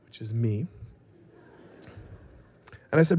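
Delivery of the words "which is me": low, soft, self-satisfied aside; thinking to oneself. Retold or imitated. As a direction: drop it like a private smug thought. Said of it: "I thought to myself".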